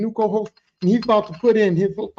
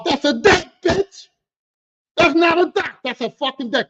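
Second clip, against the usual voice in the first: higher, louder indignant correction; exasperated outburst; 8 semitones higher, 6 dB louder than usual. Real, not acted. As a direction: snap back at the clueless customer. Angrily correcting customers about decks.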